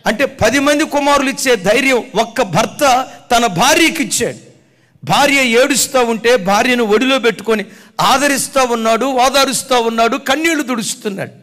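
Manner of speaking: loud, high sermon push; preaching at full force. Acted. Preacher telling the congregation how the husband comforted, loud. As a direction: proclaim it with full voice.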